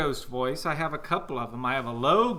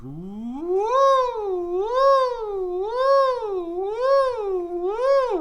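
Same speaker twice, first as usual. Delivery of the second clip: high swooping ghostly wail, rising way up; long eerie hoots far above speaking pitch; ghost woo; 7 dB louder, slower than usual. Shown as a demonstration. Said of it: "You've got some good ghost voices".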